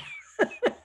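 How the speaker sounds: quick airy laugh, high in the voice. Real, not acted. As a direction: let out a short breathless giggle. Laughs at her own joke.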